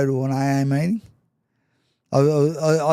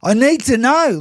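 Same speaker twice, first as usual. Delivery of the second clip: insistent demand; 9 semitones higher, 6 dB louder than usual. Retold or imitated. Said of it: "I said".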